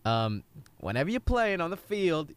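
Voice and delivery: put-on Southern accent. Old coach pep-talking a young player, lightly Southern. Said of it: "God, I went Southern".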